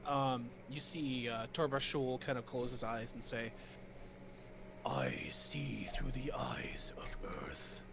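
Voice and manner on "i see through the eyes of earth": earth guardian. Acted. GM voices Torba Shul again.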